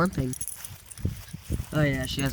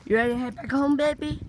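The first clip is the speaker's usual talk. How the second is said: much higher, sing-song question to a dog; sweet pet talk; 10 semitones higher than usual. Real, not acted. Asking dog to head home.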